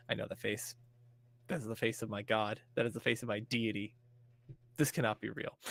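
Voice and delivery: hushed, awestruck fan; softly, as if seeing a deity; awed hush. Retold or imitated. Fan stunned seeing her idol.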